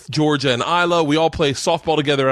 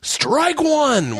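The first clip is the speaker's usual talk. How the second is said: high, bright umpire call, pitched way up; kid calling a strike. Retold or imitated. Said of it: "he's going".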